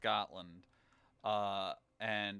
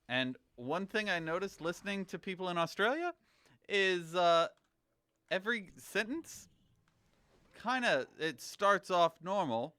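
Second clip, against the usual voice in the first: raised pitch, turning "Australia" and "up" into questions; rising intonation; 6 semitones higher, 4 dB louder than usual. Shown as a demonstration. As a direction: lift "Australia" and "up" high like questions.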